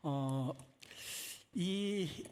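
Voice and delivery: normal talking voice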